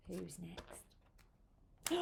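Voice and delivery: very soft whispered question; hushed suspense. Acted. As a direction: whisper it secretively to the children. Hushed suspense before next animal.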